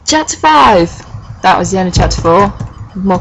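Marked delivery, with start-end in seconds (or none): 0.0-0.9 high, raised announcer call; dramatic announcement. Acted. Reader opening the next chapter after the cliffhanger, high dramatic announcement.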